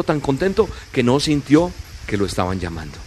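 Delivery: usual speaking voice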